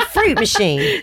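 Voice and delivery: "fruit machine" called out high, like a game-show call; announcing the card. Real, not acted. Player calls out "fruit machine" to the hosts, high and bright. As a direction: sing out "fruit machine" as you play the card. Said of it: "here we go".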